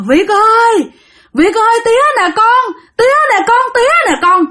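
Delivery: high, wailing father's call; anguished crying out. Acted. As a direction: cry out desperately to a lost son. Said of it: "ổng mới gào lên, ổng khóc". (gào khóc gọi con)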